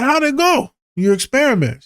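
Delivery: playful high nag; high teasing repeat. Retold or imitated. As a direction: push her again, high and playful. Pressing friend again, playfully.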